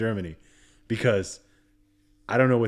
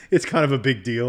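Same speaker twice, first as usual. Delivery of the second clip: laughing, louder, higher mock brag; 4 semitones higher, 7 dB louder than usual. Real, not acted. Teasing his friend about his big company, laughing. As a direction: brag through a real laugh.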